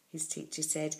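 ordinary delivery

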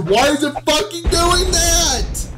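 angry protest climbing in pitch; exasperated, rising outrage. Real, not acted. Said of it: "I'm more angry".

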